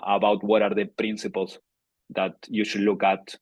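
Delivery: normal talking voice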